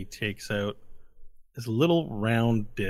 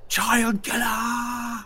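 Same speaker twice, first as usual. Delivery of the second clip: drawn-out jeer; 13 semitones higher, much slower than usual. Retold or imitated. Crowd jeer repeated, drawn out.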